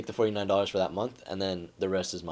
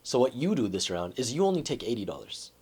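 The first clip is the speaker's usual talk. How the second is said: usual speaking voice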